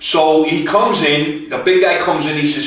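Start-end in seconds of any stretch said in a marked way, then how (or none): none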